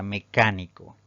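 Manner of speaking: CÁ of "mecánico" given extra force; "mecánico" stressed firmly on CÁ; antepenultimate stress. Shown as a demonstration. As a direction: lean on the second syllable of "mecánico". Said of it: "Tenemos la palabra".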